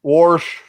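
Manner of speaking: "Wash" with an R, like "Worsh", loud; R-coloured vowel. Retold or imitated. Echoing a friend's mockery of Kentucky speech, added R. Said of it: "sounds like they add O R to it".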